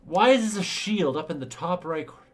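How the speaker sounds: fed-up rant; exasperated rant. Real, not acted. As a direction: rant at the interface. Ranting about bad UI.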